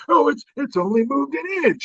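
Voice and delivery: high, put-on dismissive old-man voice; mock old politician. Comedian mocking the president's inflation excuse, in a high mock voice. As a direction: brush it off like a befuddled leader.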